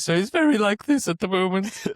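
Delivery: high, strained squeaky voice. Retold or imitated. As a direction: squeak it out like a voice that's going. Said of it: "uber squeaky voice going on".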